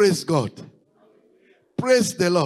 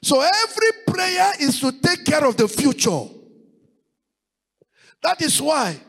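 preaching; 7 semitones higher than usual. Acted. Pastor urging the congregation to pray ahead, rising preaching cry. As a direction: drive each line home to the congregation.

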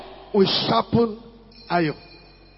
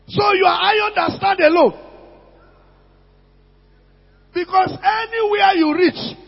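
preaching at full force; 7 semitones higher than usual. Real, not acted. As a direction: thunder it at them like a stern pastor. Preacher rebukes loners in congregation.

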